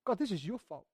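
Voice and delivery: soft, low-key accusation to God; quietly pin the blame on God; blaming. Acted. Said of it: "Jonah is blaming God".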